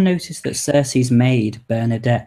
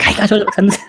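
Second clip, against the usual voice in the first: George vlog intro; 9 semitones higher, 4 dB louder, much faster than usual. Retold or imitated. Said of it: "So I'm like".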